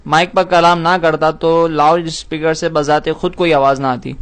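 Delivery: ordinary talk, nothing marked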